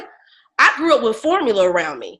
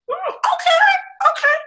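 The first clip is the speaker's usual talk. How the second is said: much higher, slow, drawn-out; mock impressed; slower than usual. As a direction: pretend to be impressed. Feigning being impressed.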